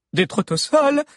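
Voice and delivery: high, loud echo of disbelief; incredulous. A narrator's reading. Valère repeating Lunella's news while running, incredulous. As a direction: repeat it as if it cannot be true. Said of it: "incrédule".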